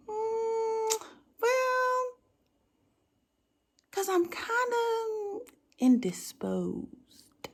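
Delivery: bashful, dragging the excuse out; coy. Acted. Coyly admitting she is naked.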